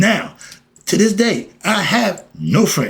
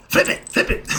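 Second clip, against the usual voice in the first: Pops. Retold or imitated. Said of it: "My man, uh, Pops".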